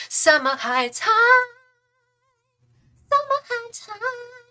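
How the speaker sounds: mock show title. Naming favourite Australian comedy show.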